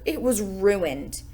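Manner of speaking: usual speaking voice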